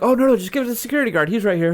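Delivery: high, rushed re-enacted reply; retold hasty reassurance. Fan telling the roadie to use the guard, high and hurried.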